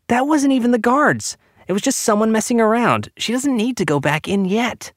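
put-on very high, urgent boy's voice; young boy protesting. A narrator's reading. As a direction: plead and argue in a high pitch. Boy protesting to his mother.

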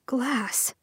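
a soft strained plea; low and strained. Acted. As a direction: hold back hurt as you say her name. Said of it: "Luke's voice was low and strained".

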